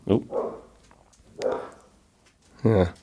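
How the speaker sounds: ordinary talk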